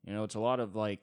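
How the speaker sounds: ordinary talk, nothing marked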